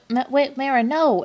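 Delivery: lightly hammed-up alarm; retold alarm. Retold alarmed objection to companion.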